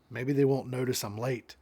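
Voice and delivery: usual speaking voice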